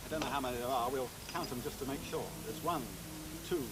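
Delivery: measured showman narration over music; magician's stage patter. Acted. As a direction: present each trick with a flourish. Magic show presenter patter.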